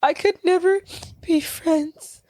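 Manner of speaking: high, halting whiny delivery; mock whimpering. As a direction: force it out in pieces, imitating a tearful whimper. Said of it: "whimpering and whining and crying".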